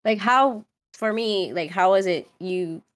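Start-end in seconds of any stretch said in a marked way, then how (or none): none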